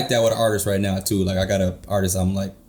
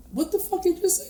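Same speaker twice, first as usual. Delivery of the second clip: high, softer acted disbelief; baffled reaction; 4 dB quieter than usual. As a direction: replay your puzzled reaction, pitched way up. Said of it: "and be like".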